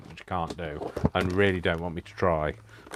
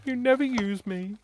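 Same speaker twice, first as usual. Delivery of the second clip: high squeaky complaining voice; jelly plate's voice; 14 semitones higher than usual. Acted. The unused jelly plate complaining to its owner, high and whiny. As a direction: whine it as the neglected plate. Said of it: "been going".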